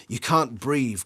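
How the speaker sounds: ordinary delivery, nothing marked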